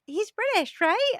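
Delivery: high-pitched imitation of a nosy question; inquisitive stranger. Stranger asking about a toddler's nationality, high and inquisitive. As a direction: lift it high, fishing for a yes.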